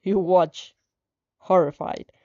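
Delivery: usual speaking voice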